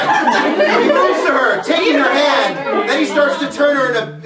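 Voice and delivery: giggly slow narration; laughing reading. Real, not acted. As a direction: read while cracking up.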